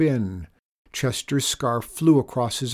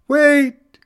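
very high, loud yell; calling out in alarm; 13 dB louder than usual. A narrator's reading. Mister Bond as the boat shoots forward, calling out in alarm. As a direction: yell it out into the wind. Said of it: "His voice faded in the wind".

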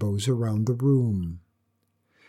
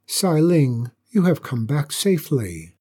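ordinary delivery

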